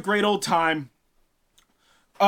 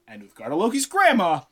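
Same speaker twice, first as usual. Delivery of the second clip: ordinary talk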